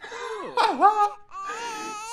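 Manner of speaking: very high delighted squeal-laugh. Real, not acted. Player squeals at the cute baby, very high.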